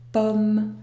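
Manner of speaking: "pomme" with an open O and a sounded M; slow "pomme", oral vowel closing on a clear M; non-nasal vowel. Shown as a demonstration. Teacher showing how the word is pronounced, non-nasal O in "pomme". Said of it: "Le mot se prononce".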